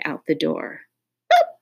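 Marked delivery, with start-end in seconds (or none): 1.3-1.6 sharp little hiccup boop. A narrator's reading. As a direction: pop out a hiccup. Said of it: "hiccuped D J".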